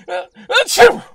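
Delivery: high loud fake sneeze. Motif sneezes.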